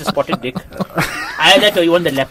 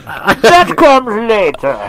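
very slow, drawn-out villain voice, pitched up; Bane; 6 semitones higher, much slower than usual. Acted. Teasing the others as a masked villain, slow Bane voice. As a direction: stretch each word like a masked villain. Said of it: "That's Bane".

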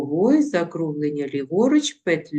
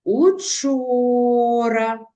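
"учора" said very slowly and higher, leaning on "чо"; stressed syllable drawn out; 4 semitones higher, much slower than usual. Shown as a demonstration. Teacher showing children the stressed syllable in "учора", slow and drawn out. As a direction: stretch "учора" out so the stressed "чо" stands out. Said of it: "Вимовимо".

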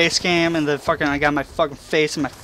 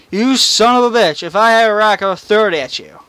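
higher, louder mock rage at the bird; retold angry yell; 6 semitones higher, 7 dB louder than usual. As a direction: yell it up at the bird that pooped on you.

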